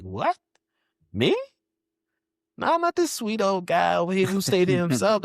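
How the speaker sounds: mock innocence. Man mocking his own denial of being angry, high and innocent. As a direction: play the innocent, denying it all. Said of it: "And I'm like".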